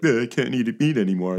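lightly imitated sheepish coworker; mock sheepish admission, in a slightly put-on voice. Coworker admitting he must go vegetarian, imitated sheepishness. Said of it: "he's all sheepish".

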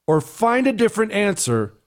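normal talking voice

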